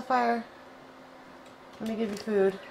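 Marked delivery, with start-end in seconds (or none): none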